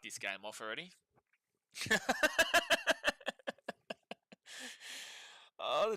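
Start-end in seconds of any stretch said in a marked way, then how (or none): 1.8-4.1 sudden high-pitched burst of laughter. Real, not acted. Laughing at his own outburst on stream, high and sudden. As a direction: cackle up high, caught off guard.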